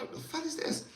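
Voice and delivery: puzzled squeak; confused surprise. Retold or imitated. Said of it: "I'm like".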